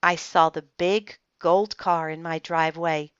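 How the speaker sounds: usual speaking voice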